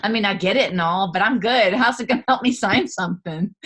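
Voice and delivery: hammed-up know-it-all; mock dismissive. Brushing off Stacy's advice, mock flippant. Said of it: "I'm like".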